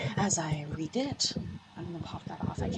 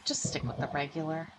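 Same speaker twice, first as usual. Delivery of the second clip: soft aside; 5 semitones lower, 13 dB quieter, faster than usual. Retold or imitated. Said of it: "R L S says".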